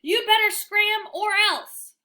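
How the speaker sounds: high, loud and slow threat; threatening bark. A narrator's reading. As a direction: growl out the warning slowly. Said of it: "All I can do is bark".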